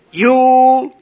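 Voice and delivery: high, drawn-out mock scream. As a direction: imitate the furious sponsor screaming, stretching each word. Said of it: "he started screaming".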